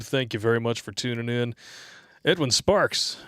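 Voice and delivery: ordinary talk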